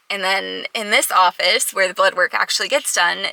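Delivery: ordinary delivery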